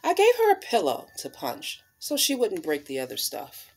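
expressive picture-book storytelling voice; reading to children. Acted. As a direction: read it like storytime on the rug.

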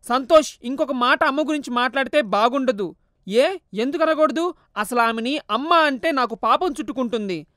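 a mother's sharp warning and son's defiance; mild acted quarrel; heated argument. Mother and son argue.

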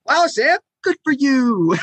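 high sarcastic congratulations with a laugh; mock praise. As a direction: gush falsely at a know-it-all. Mock praise for knowing penta.

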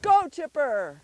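loud, high send-off, falling at the end; shouted command. Real, not acted. Owner sending dog after the ball, shouted command.

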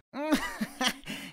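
a high, pleased chuckle; Krusty laughing at the joke; giant's laugh. A narrator's reading. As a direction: laugh along, flattered. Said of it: "Krusty rise".

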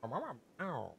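imitating a cat's meows; cat meowing. Streamer imitating the cat begging for chicken. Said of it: "she was like".

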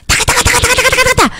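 rapid-fire high-pitched "taka" gun noise; imitated gunfire. Gamer's gunfire waking a sleeping partner, imitated high and rapid. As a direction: mimic machine-gun bursts in a high, rattling staccato. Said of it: "all of a sudden you hear".

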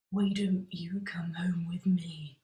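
slow, breathy-sounding Bristol come-on, half whispered; mock sexy Bristol accent. Scary mate hits on comic, mock sexy Bristol accent. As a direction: seduce in a put-on Bristol accent. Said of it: "I'm about to do a Bristol accent now. And it is devastatingly sexy".

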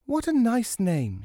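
high-pitched mother's praise; approving mother. A narrator's reading. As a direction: praise your child in a high voice. Mother praises child's name.

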